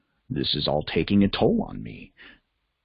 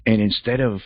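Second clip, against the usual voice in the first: ordinary delivery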